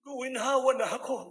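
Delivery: healed patient crying out loud; joyful shout. Retold or imitated. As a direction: shout out the healing.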